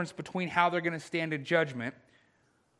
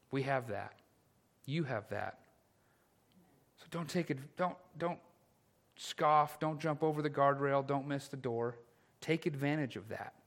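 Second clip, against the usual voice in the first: softer, earnest urging to the congregation; soft pastoral appeal; 2 semitones lower, 7 dB quieter than usual. Acted. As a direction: lean in and plead gently. Quiet pastoral exhortation.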